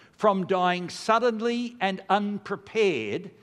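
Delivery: slow, measured liturgical reading; prayer read slowly. Shown as a demonstration. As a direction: read each word of the prayer slowly and solemnly. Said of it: "Now listen to this prayer".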